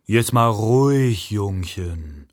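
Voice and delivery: lightly voiced slow, patronising heavy; slow it down to put him in his place; a hint of a slow, looming tough guy; condescending big man. A narrator's reading. Big man rebukes the pusher.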